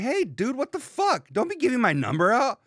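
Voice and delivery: high, indignant mock scolding; mock angry friend. Imitating a friend scolding him for sharing his number. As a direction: squeak it out like an offended buddy. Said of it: "he's like".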